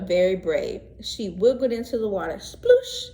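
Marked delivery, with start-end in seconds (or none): 2.3-3.1 playful splash noise, high and loud; cartoon splash; high loud sploosh. A narrator's reading. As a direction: make a big splash sound. Splash sound in story.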